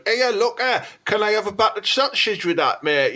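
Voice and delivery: high-pitched, exaggerated Teesside accent; Teesside twang. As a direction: put on a high Teesside twang, easing down at the end. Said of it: "there is a distinctive twang".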